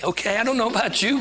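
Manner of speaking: ordinary delivery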